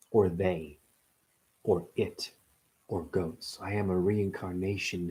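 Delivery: hushed oracle voice; mystical proclamation. Acted. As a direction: intone it like a mysterious revelation. Mystical reincarnation monologue.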